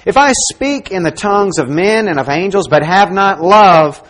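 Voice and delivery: loud, emphatic Bible reading; scripture proclamation. Acted. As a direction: proclaim the verse forcefully to the whole congregation. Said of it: "God tells us this".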